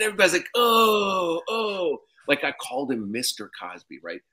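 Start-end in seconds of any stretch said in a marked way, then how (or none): 0.5-2.0 high, loud collective groan; crowd gasp. Retold or imitated. Whole cast reacting to his blunder with a groan.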